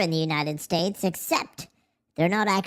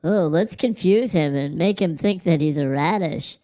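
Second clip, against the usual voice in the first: mocking chat; 6 dB louder than usual. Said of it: "You're all like".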